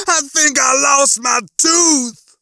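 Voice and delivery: high, loud, drawn-out wail; yelling. A narrator's reading. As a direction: wail it out in alarm. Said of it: "he was yelling".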